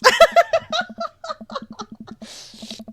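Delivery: loud, very high cackling laugh; helpless laughter. Real, not acted. Streamer cracking up over the rescue scene, high cackle. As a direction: burst into a long, high laugh.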